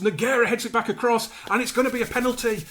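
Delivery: rising thrill of a penalty; excited commentary. Real, not acted. Penalty awarded.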